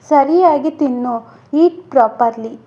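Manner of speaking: usual speaking voice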